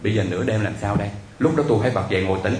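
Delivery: normal talking voice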